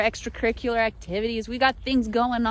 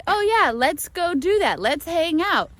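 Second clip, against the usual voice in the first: breezy American promise; 6 semitones higher than usual. Retold or imitated. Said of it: "they say".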